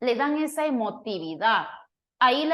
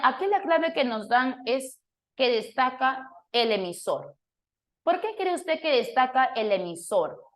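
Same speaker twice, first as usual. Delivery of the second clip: usual speaking voice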